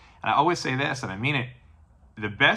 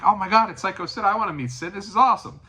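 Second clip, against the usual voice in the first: higher, louder thrilled squeal; excited little girl; 9 semitones higher, 3 dB louder than usual. Retold or imitated. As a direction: gush like a kid meeting her hero. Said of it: "like, so excited".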